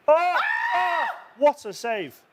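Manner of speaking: shouting high and loud over a great save; excited shout. Real, not acted. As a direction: let it burst out at full volume, real amazement. Live reaction to big save.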